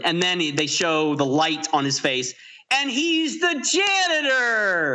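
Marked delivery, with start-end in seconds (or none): 2.7-5.0 higher, slow excited shout; big dramatic reveal. Real, not acted. Horror reveal climax.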